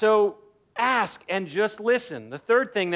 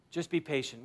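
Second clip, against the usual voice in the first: gentle; 4 semitones lower, 9 dB quieter than usual. Real, not acted.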